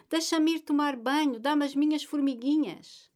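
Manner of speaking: high, loud teasing plea for her cap; playful loud plea. A narrator's reading. Mother chasing her son for her cap, playful loud plea. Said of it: "dizia Ana Maria".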